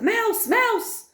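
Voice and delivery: alarmed shouts. A narrator's reading. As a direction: cry out in alarm.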